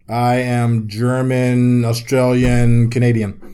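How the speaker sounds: slow, drawn-out list, word by word; deliberate deadpan recital; mock-solemn announcement. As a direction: announce each one slowly, pretending to be serious. Acting out invented mixed-race answer.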